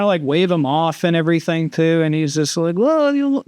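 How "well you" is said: lightly put-on bad Russian accent; higher mock Russian pilot voice; imitating the Russian pilot, half-hearted accent. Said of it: "I'm just my terrible accent".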